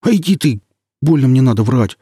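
high, loud offended snap; indignant outburst. Acted. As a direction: bristle at being called a liar. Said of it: "сплюнул со злостью".

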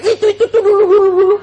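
high, shrill-pitched mock wife dredging up the past; preacher imitates a wife bringing up old fights, high and loud; mimicking quarrelling wife. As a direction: squeal it like a wife raking up old grievances.

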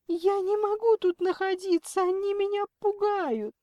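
very high, frightened wail; tearful pleading. A narrator's reading. Kidnapped Lena begs mother to pay, tearful pleading. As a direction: beg desperately in a high, loud whine.

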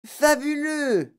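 high, drawn-out cry of delight; excited yell. A narrator's reading. Girl raving about the magic gum, excited yell. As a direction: stretch it out in high-pitched raving. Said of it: "hurla Violette".

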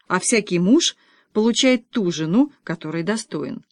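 usual speaking voice

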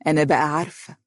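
smiling, sly certainty; confident, knowing. Acted. Mother hints she knows the secret, knowing smile. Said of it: "لاحت ابتسامة واثقة على شفتيها وقالت".

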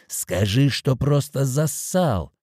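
put-on mild drunken yell, slow and drawn out; breaking into shout. A narrator's reading. Drunk man taunts his friend, breaking into a mild shout. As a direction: stretch the taunt into a half-held-back cry. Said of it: "Голос Михалыча сорвался на крик".

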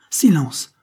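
a loud sharp command, cut off; husband silencing his screaming wife, loud and sharp; sharp silence. A narrator's reading. Loud sharp command. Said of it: "lui dit ce dernier".

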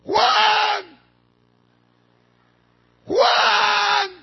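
high, drawn-out holler of a name; imitated shout. Revived old woman calling her husband from the bedroom, shouted. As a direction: act out her loud cry, stretched long. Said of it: "gritó".

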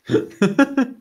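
a short, high-pitched laugh; burst of laughter. Real, not acted. Laughs after settling the point.

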